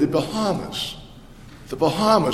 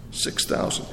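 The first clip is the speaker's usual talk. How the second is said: whispered repeat; 9 dB quieter than usual. Shown as a demonstration. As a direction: hush the number for awe. Answer, then whispered repeat.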